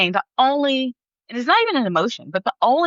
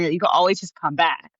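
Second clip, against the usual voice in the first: ordinary talk, nothing marked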